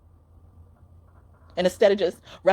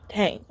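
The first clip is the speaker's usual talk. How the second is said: whispered sigh on dang; shaky sigh; 3 semitones lower than usual. Real, not acted. Reader to viewers, sighing dang. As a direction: breathe out the word in a sigh. Sighs while fighting tears.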